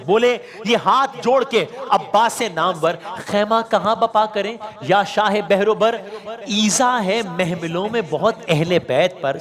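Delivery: measured marsiya delivery; verse recitation. Acted. Preacher reciting Abbas's question in verse to listeners. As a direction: recite the verse with slow weight.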